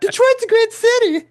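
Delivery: very high laughing rock-star purr. Retold or imitated. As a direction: croon it through a laugh. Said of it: "Ray went".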